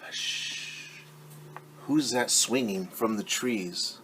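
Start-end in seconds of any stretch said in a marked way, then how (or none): 0.0-1.0 breathy shushing whisper; hushed whisper. Acted. Storyteller whispers hush to listeners. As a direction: hush the room so baby sleeps.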